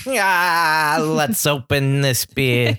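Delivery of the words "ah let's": weird voice. Pretend. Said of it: "I've done a lot of, like, weird voices today".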